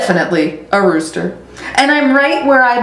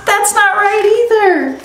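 much higher, startled no wait; flustered surprise; 12 semitones higher than usual. Acted. Rudy startled that his crow went wrong, high and flustered. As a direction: catch yourself, startled and flustered.

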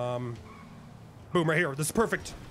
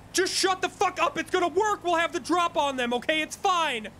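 real high-pitched exasperated insistence; 6 semitones higher than usual. Real, not acted. Boss overruling worried teammates, exasperated. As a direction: shut them down, voice pitched up.

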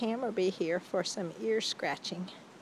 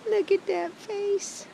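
much higher and slower, stretched out for the dog; high slow cooing; 11 semitones higher than usual. Real, not acted. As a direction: coo the line slowly up high. Second take, cooing at dog.

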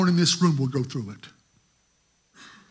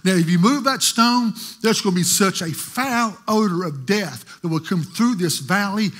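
animated preaching retelling; 4 dB louder than usual. Real, not acted. Preacher dramatizing Martha warning Jesus at the tomb, loud and animated. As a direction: act out Jesus and Martha for the church.

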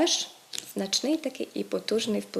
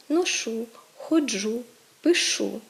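ношу, ходжу, пишу stressed on the final vowel; correct end stress; slower than usual. Shown as a demonstration. Teacher models correct first-person verbs, stressed on endings. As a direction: list ношу, ходжу, пишу apart, hitting each last syllable. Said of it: "правильно казати".

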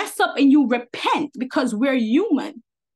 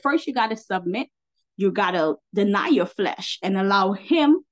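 normal talking voice

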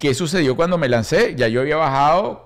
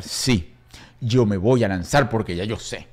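mock bratty insistence; 6 semitones lower, 3 dB quieter, slower than usual. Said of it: "de malcriadez".

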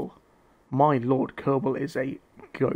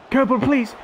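pleading, pitched way up and loud; real desperate plea to the keeper; 9 semitones higher, 6 dB louder than usual. Real, not acted. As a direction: beg the keeper to pull off the save. Begging keeper to save.

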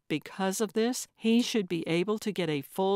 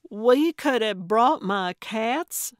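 louder, higher, slow disbelieving cry; astonished; 4 semitones higher, 6 dB louder, slower than usual. A narrator's reading. Gertrude realizing to Calvin she could have brought cats, astonished. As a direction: let the surprise burst out slowly. Gertrude's mouth fell open.

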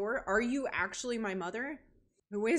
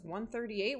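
ordinary talk

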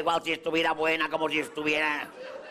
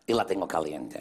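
low punchline offer; 9 semitones lower than usual. Retold or imitated. Beautician offers client the hot wax, low voice. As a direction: drop very low on the last option.

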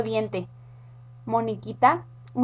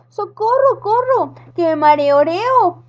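high loud panicked witch cry; witch screaming for help; 7 semitones higher, 5 dB louder, slower than usual. Acted. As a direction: scream for help while sliding.

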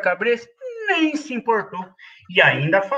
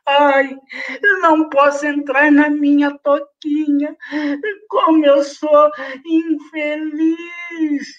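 higher, slow whimpering lament; crying bunny; 6 semitones higher, slower than usual. Acted. Bunny locked out of his home, whimpering. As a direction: whine like a sad little bunny. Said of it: "começou a chorar".

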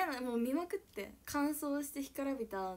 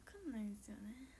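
whisper; 10 dB quieter than usual. Real, not acted. Whispered closing remark.

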